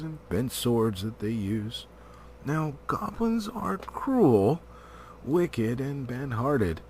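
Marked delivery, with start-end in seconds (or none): none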